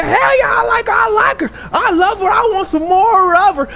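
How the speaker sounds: high, loud hammed-up lovestruck shouting; wilding out. Tom tells Oprah he likes Katie, wild shouting. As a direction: holler it like an over-excited man in love. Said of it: "when Tom really got to wilding out".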